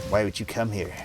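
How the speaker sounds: quiet, detached mother; distant, cold. A narrator's reading. Mother to returning daughter, distant and mildly cold. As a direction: keep it understated and cold, held at a distance. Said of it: "Her voice was distant, cold".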